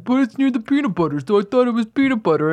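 high complaining customer voice; whiny shopper voice. Retold or imitated. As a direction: whine like a duped shopper. Mocking shoppers who get fooled.

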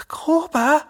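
high, strained questioning call; hoarse call. A narrator's reading. Jerome calling to his friend who has just collapsed, hoarsely. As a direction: call the name in hoarse alarm. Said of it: "ruft er heiser".

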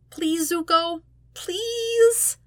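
very high, desperate begging; begging Sokka. Acted. Sokka pouts at Zuko with puppy dog eyes, begging in a very high voice. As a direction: whine the last please way up like puppy eyes.

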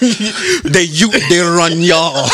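hammed-up Caribbean lilt; a playful stab at a Caribbean accent; mock Caribbean accent. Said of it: "put a Caribbean accent".

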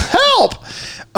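high, hammed-up cry of Help!; mock distant yell. Imitating a stranger yelling from their machine, high mock cry. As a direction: squeal it like someone far off in trouble. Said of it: "heard somebody yelling".

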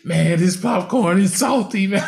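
high-pitched, hammed-up tearful excuse; mock holding back tears. Moviegoer making excuses to his date, mock tearful. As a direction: play covering up a cry, voice pushed high. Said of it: "I'm trying not to ugly cry".

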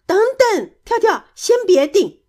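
high, loud urgent call; urgent warning. A narrator's reading. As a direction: cry out to stop someone. Urgent warning before the crash.

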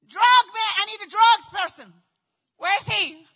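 high, loud comic summons; frantic calling out. Retold or imitated. Waking patient calling the drugs person, frantic shout. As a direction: holler for help as if desperate.

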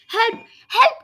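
panicked yelp as the bridge burns; screaming in horror. A narrator's reading. Mouse on the burning bridge, high panicked cry. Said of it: "I screamed in horror".

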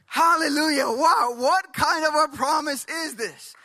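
high, loud burst of praise; exultant wonder. Real, not acted. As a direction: marvel out loud like an elated preacher. Preacher exclaims over the promise.

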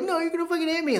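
high-pitched mock refusal; squeaky pretend fear; frightened woman. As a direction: imitate a scared woman, pitched well up. Woman afraid behind door, retold.

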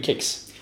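"kex" corrected to a hard k. Shown as a demonstration. Host correcting guest's variant, hard K in "kex". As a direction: say "kex" with plain K, not tje. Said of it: "Det heter ju inte så".

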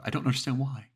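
high, loud and rushed with terror; panicked caller. Acted. Frightened woman telling the dispatcher about the face, panicked. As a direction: plead for help as if something is at the window. Terrified caller on emergency call.

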